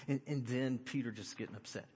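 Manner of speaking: ordinary delivery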